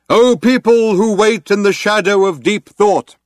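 high, loud address to a crowd; crying out. Acted. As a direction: proclaim it like an orator over a tannoy. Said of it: "he cried out".

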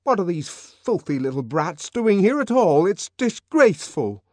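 high, loud, indignant outburst; intense. Acted. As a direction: spit out disgust. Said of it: "voice was trembling with indignation".

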